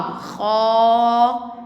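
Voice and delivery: خا held out long on a steady aa; elongated madd. Shown as a demonstration. Teacher showing kha joined to alif, elongated sound. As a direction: stretch خا well beyond a short vowel. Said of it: "مد وممدود".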